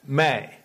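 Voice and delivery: "may" rhyming with day; Greek pronunciation. Shown as a demonstration. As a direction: say "may" as one long-a syllable. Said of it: "we would pronounce that".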